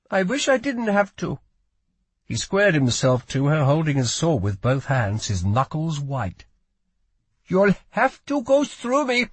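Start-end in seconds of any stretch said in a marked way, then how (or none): 0.1-1.4 higher-pitched regret; reluctant plea. A narrator's reading. Lila reluctant to hurt young guard, reluctant plea. 7.5-9.3 put-on high, accented young voice; defiant boy guard. A narrator's reading. Boy squares up to Lila with sword, defiant.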